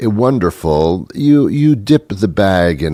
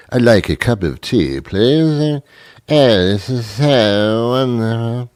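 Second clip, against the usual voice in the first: mock-genteel British tea drinker; slow, hammed-up British politeness; British accent; 4 semitones higher than usual. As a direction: put on a little British accent, drawing out the last words. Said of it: "a little British accent when you drink tea".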